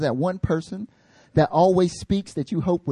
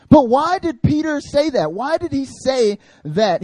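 much higher, slow, drawn-out question; probing rhetorical question; 10 semitones higher, 5 dB louder, slower than usual. Real, not acted. Pastor asking congregation, slow high rhetorical question. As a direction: lean into the question for the crowd.

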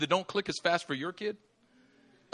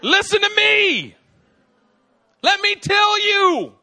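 much higher, louder, slow daddy shout; God as exasperated father shouting; mock shout; 12 dB louder, slower than usual. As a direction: shake them with a hammed-up roar. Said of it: "God just wants to shake you".

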